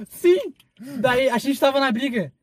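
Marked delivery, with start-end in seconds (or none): 0.1-0.5 sim imitado. Retold or imitated. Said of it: "Daí a gente falou".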